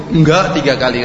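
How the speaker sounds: wife refusing her husband's plea; firm refusal. Retold or imitated. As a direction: cut him off with a firm no.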